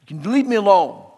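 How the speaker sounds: loud mock shout at the kids; retold shout. Girl shouting back at mocking classmates, loud. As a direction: shout them down. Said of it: "I finally said".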